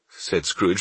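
plain reading voice

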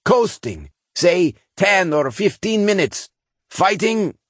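high, slow gruff engineer; old engineer voice. Acted. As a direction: speak like the weary chief engineer. Old engineer over intercom.

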